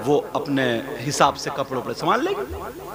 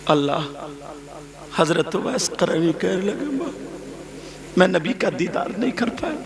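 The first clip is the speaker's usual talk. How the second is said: high, loud, slow weeping sermon; impassioned preaching; 12 semitones higher, 4 dB louder than usual. Acted. Preacher crying out the story of Uwais and his mother. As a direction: preach the mother's grief at full voice.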